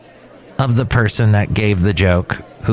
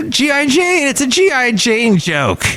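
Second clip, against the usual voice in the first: high mocking voice of the joker; mock excuse; 12 semitones higher than usual. Friend backpedalling after a bad joke, mock high voice. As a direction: squeak it out like a guy backpedalling.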